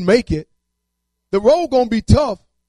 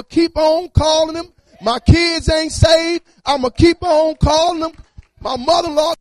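preaching cry; 5 semitones higher, 4 dB louder than usual. Real, not acted. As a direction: cry it out to the congregation. Preacher crying out in sermon.

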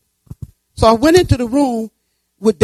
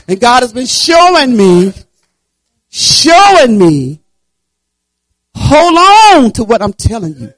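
rising preaching; 4 semitones higher, 9 dB louder, slower than usual. Acted. Preaching builds.

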